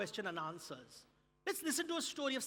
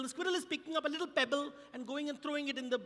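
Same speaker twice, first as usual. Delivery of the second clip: usual speaking voice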